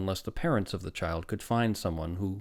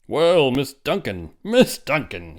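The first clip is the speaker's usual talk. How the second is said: loud, drawn-out hearty greeting; booming; 7 semitones higher, 11 dB louder, slower than usual. A narrator's reading. Doctor greets a woman in the waiting room, booming. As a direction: boom it out, big and higher-pitched. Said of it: "he boomed with importance".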